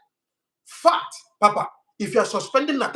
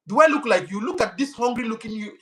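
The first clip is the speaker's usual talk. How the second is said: ordinary talk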